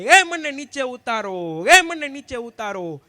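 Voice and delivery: loud, slow, drawn-out yell; hammed-up cry to be let down; comic shouting plea. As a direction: yell it like a man stuck on the bier. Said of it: "રાડું પાડે".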